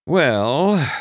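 high, drawn-out pondering; thoughtful. A narrator's reading. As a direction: mull it over aloud. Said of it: "Doc said thoughtfully".

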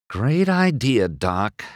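usual speaking voice

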